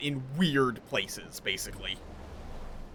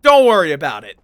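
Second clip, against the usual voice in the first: louder, brushing it off; loud dismissive; 2 semitones lower, 10 dB louder, faster than usual. Real, not acted. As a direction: say it loud, waving it away. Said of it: "talking like this".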